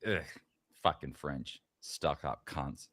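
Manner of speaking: low, soft disgusted grumble; mock disgusted grumble, low and soft; grumbling Canadian. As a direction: mutter it with contempt. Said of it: "they all like".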